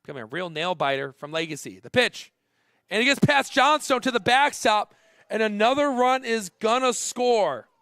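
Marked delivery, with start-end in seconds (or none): 1.8-7.7 loud, high call as the play breaks; urgent, raised sportscaster shout; excited play-by-play. Real, not acted. Passed ball, run scores live.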